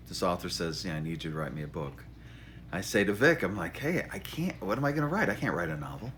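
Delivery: ordinary delivery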